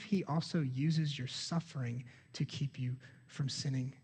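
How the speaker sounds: soft, slow whispered question. Real, not acted. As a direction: draw the congregation in, barely voiced. Preacher hushes a probing question.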